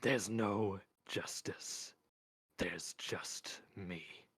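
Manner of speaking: deliberate doom-laden sentence, fading at the end; ominous pronouncement. A narrator's reading. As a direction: leave space round every word. Mort pronounces like Death.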